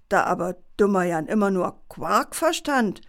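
ordinary delivery

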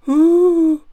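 sudden loud high sobbing gasp; teddy bursting into a sob. A narrator's reading. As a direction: let out a big sob. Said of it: "Und er weint schrecklich".

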